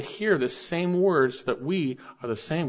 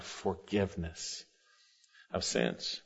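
breathy hush; 7 semitones lower, 14 dB quieter, slower than usual. Real, not acted. Pastor trails verse into hush.

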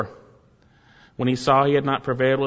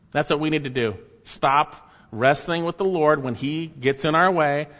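normal talking voice

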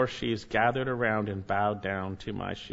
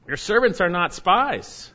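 slow, higher, louder denial; pleading denial; 6 semitones higher, 5 dB louder, slower than usual. Acted. Brothers begging Joseph, a slow loud denial. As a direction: beg him to believe you. Brothers deny being spies.

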